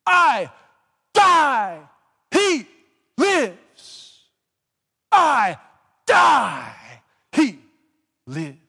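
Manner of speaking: put-on loud, high British-accented chant of I die, he lives; booming British-accent preacher cry; shouted British-accented imitation. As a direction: belt it like an old British preacher. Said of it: "With a British accent, he would say".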